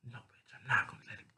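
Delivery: fast, voiceless whisper; whispered refusal. Acted. Salem refusing to let her lover go, in a quick whisper. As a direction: whisper it quickly under your breath.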